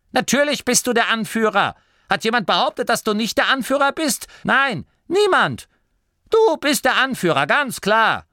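high, loud patronizing granny; a bossy granny soothing a sulky leader; placating old woman. A narrator's reading. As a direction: humor him like a child. Oma Morky placates Turret.

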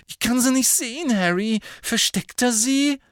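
high, loud lovesick whine; lovesick moaning Ron. A narrator's reading. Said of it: "stöhnte".

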